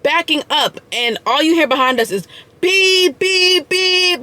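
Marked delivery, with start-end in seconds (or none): 2.6-4.2 high, drawn-out honking beeps; car horn imitation. Mimicking horns behind the reversing car, high beeps. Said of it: "just all these horns beeping".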